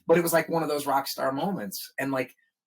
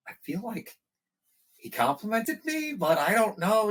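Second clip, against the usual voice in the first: unsure self-quote; 10 semitones higher than usual. Retold or imitated. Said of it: "I said".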